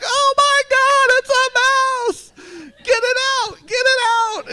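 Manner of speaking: high, loud, panicked mock screaming; retold screams. Pastor reenacting his daughter finding the mouse, shrieking high. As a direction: shriek it like she just saw the mouse. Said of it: "the loudest blood-curdling screams".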